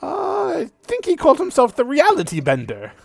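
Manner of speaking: put-on high, loud, cackling old skeleton; booming high-pitched skeleton voice with a cackle; skeletal archdruid. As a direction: play a jolly undead druid, way up high.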